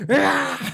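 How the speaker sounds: mock battle yell. Imitating someone ripping a seat.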